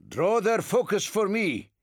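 commanding order. Acted. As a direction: bark the order to the squad. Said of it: "he ordered his squad".

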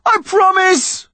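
high, drawn-out desperate yell; yelling back. A narrator's reading. Connor promising his mother he'll hold on, desperate yell. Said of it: "Connor yelled back".

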